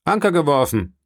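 a restrained call to the crew; Zuruf an die Mannschaft. A narrator's reading. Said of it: "rief er der Mannschaft zu". (verhaltener Zuruf an die Mannschaft)

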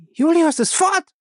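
high, loud scream. Acted. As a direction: scream it in alarm. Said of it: "schrie Faye".

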